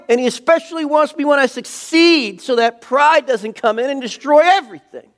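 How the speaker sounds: high, loud, impassioned sermon shout; full-force preaching. Real, not acted. Pastor challenging congregation on scripture, preaching at full force. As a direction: proclaim it to the whole room with rising fervour.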